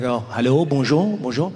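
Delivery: put-on French-accented greeting; older French gentleman. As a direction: play an older Frenchman saying hello. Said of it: "It's an older French gentleman".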